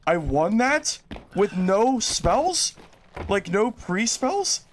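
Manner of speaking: can't believe you just won; real astonishment, pitch climbing on each question; stunned disbelief. Real, not acted. Stunned by an unexpected win.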